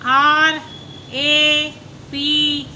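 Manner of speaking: slow letter-by-letter spelling. Shown as a demonstration. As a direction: spell ए पी slowly, each letter apart. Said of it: "teacher spells word slowly".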